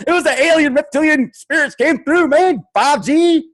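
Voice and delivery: high, loud mock stoner voice, man; a lazy surfer-dude parody, pitched high and loud. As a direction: ham up a spaced-out conspiracy guy. Parodying conspiracy believer.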